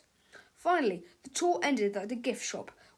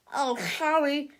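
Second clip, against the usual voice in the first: put-on nasal boy voice; 4 semitones higher, 5 dB louder, slower than usual. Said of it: "said Melvin".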